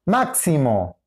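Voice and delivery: "Máximo" stressed as MAK-si-mo; stress on first syllable. Shown as a demonstration. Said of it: "La ubicación del acento". («Máximo» con acento en la primera: MÁK-si-mo)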